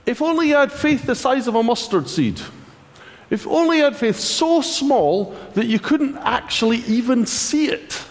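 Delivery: mock-generous, dripping with sarcasm; sarcastic Jesus. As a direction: pretend to offer hope, but mean the opposite. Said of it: "He's being a little sarky".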